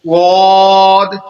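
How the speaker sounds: واد with a thick hissing s, loud and very slow; واد as emphatic saad, full-mouthed; heavy whistling s. Shown as a demonstration. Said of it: "سیٹی کی آواز نکالتے ہوئے اسے موٹا کر کے".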